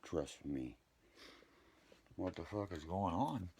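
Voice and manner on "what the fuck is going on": normal talking voice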